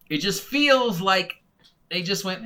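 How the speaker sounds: usual speaking voice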